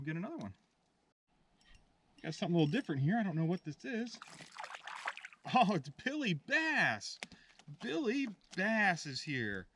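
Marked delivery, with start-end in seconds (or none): 5.5-9.7 delighted surprise. Real, not acted. Surprise catching a bass.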